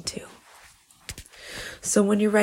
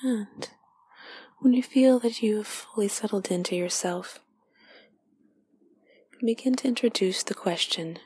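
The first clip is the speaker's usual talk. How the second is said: guided meditation; 2 semitones higher than usual. Acted. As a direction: lead them inward gently, half whispered.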